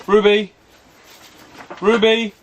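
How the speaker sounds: loud high call across the garden. Real, not acted. Owner calling his bulldog from the shed, loud. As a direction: shout the dog's name to fetch her.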